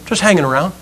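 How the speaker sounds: soft, dejected acorn voice; winded, upset acorn. Acted. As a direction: lightly play a spent little acorn. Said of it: "He's kind of winded. He's looking a little upset".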